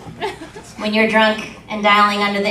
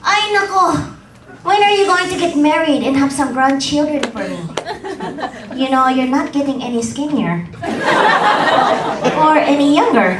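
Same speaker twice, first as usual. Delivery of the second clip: mom voice; 5 semitones higher than usual. Retold or imitated. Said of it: "And she's like".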